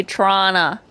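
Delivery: "Toronto" with no T, clipped. Shown as a demonstration. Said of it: "you gotta say".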